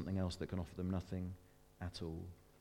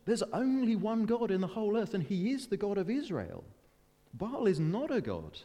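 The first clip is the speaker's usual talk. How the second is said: high, loud proclamation; emphatic rebuke; 10 semitones higher, 4 dB louder than usual. Acted. Preacher proclaiming the one true God, emphatic. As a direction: declare it forcefully, pitched well up.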